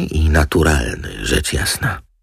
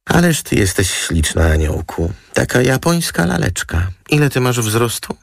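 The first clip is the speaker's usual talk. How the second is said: high-voiced admiring model; high, sugary chatter; gushing model; 9 semitones higher than usual. A narrator's reading. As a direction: coo over someone, pitched up. Model gushes at Roma.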